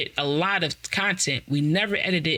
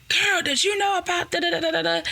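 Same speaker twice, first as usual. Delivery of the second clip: put-on high, breathless girl-talk; excited gossip; 13 semitones higher than usual. Calling her sister, high excited gossip. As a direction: burst in with news.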